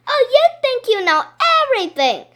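high, loud mocking retort; sarcastic kid. A narrator's reading. Boy mocking his bossy classmate, high and loud. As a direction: needle her like a know-it-all kid. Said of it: "I said".

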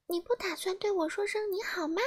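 high, light elf voice; a tiny elf sounding a little put out; small fairy voice, high and soft; little elf asking. A narrator's reading. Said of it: "小精灵问道".

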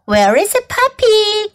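high-pitched calling question; worried cry. A narrator's reading. Kids search for puppy after the crash, crying out. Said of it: "cried the kids".

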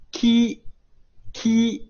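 舌位隆起读ki; model ki. Shown as a demonstration. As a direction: lift the tongue and say ki. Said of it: "舌位隆起啊".

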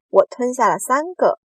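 high, loud one-upping; loud boast. A narrator's reading. Centipede topping the others' swallowing claims, loudly. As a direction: outdo them at full voice. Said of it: "蜈蚣大声说".

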